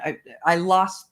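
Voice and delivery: usual speaking voice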